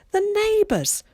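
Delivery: put-on teenage daughter screeching at her mum. A narrator's reading. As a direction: screech it in mocking disbelief. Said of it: "she screeched".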